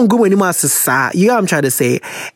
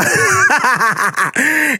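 real burst of higher laughter; helpless laugh; 6 semitones higher than usual. Real, not acted. Host cracks up at her own choice of letter, laughing.

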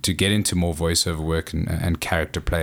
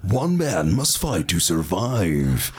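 movie trailer voice; much slower than usual. Retold or imitated. At twelve, mimicking a trailer narrator. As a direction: boom it out like an epic trailer. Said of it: "I was like".

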